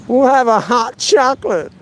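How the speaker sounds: high-pitched, hammed-up Belgian accent; badly faked Belgian voice; terrible Belgian accent. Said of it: "That was a terrible Belgian accent".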